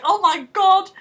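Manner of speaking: high, loud mock shock; mock exasperation. Brad Pitt reacting to Cruise, high mock outcry. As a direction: throw up your hands in mock horror. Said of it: "he's like".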